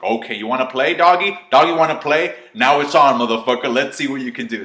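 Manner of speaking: playful taunt building into a loud challenge; Buu. Acted. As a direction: goad an opponent into playing, punchy and loud. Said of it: "Buu just smiles. He's like".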